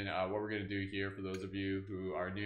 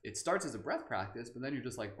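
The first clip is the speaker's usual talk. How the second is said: usual speaking voice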